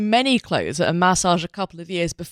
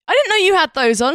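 high, loud mock dismay; exaggerated shocked protest; retold alarm; 10 semitones higher, 5 dB louder than usual. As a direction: act out startled realisation, pitched up. Retells own alarmed reaction to ex.

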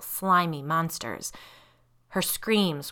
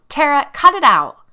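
high, loud scolding shout; angry yell; 10 semitones higher, 15 dB louder than usual. A narrator's reading. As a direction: yell at your sister to stop. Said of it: "Michaelina yelled".

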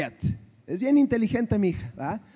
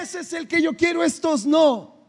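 a father mimicking his daughter's whiny pleas for one more try; whiny begging daughter; 5 semitones higher than usual. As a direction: beg like a kid who wants the one toy. Daughter whining for another try.

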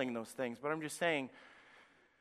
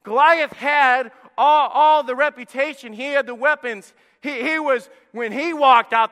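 full-force preaching; 9 semitones higher, 14 dB louder than usual. Acted. Preacher to his congregation, loud full-force preaching.

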